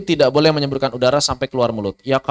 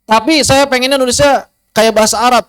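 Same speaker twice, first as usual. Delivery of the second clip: a hint of a student's high voice, objecting; lightly voiced pupil protesting; suara murid protes; 8 semitones higher, 4 dB louder than usual. Murid membantah ustaz. (suara murid yang sedikit tinggi, membantah)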